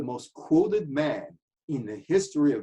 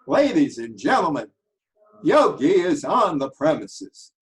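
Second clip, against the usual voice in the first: Howard Cosell impression; 3 semitones higher, 7 dB louder than usual. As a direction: announce it like a grand ringside broadcaster. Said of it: "in this Howard Cosell voice".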